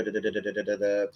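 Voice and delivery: low, soft run of da-da-da; filler "da-da-da". Real, not acted. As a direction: stall with a low string of syllables. Fills a pause while recalling fixture.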